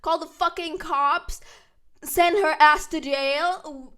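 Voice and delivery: loud, slow furious order; loud demand. Retold or imitated. April telling Chloe to call the cops, loud and slow. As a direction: bark it at your friend.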